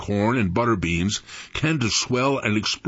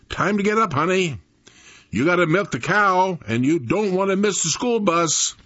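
high, singsong motherly wake-up voice; mother's wake-up call; 8 semitones higher than usual. A narrator's reading. Mother waking her son to milk the cow, high motherly call. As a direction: call it out higher, coaxing a child up.

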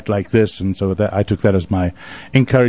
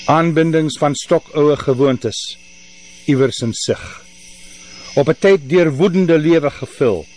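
slow, solemn Afrikaans recitation, higher pitched, long pauses; poem recital in Afrikaans; 4 semitones higher than usual. Acted. Poet reading the Afrikaans version of his poem, slow and solemn. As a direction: chant the verses in Afrikaans like an old lament.

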